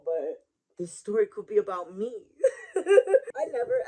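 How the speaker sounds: breaking into high, loud laughter; laughing. Real, not acted. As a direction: talk through a rising burst of laughter. Laughing at own joke.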